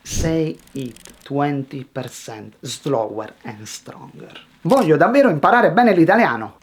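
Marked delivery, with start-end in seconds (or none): 4.7-6.6 rushed, louder and higher; nervous learner racing through the sentence; too fast. Shown as a demonstration. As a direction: rattle it off with no word standing out. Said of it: "nerves make you speak too fast".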